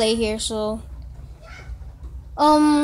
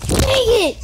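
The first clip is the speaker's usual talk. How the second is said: high, loud annoyed yell; frustrated shout; 9 semitones higher, 5 dB louder than usual. Real, not acted. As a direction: bark out the frustration. Frustrated after dying in game.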